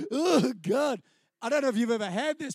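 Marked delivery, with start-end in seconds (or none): 0.1-1.0 louder, choked-up oh God; moved and embarrassed; a retold half-sob; overcome oh God. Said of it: "And I'm going".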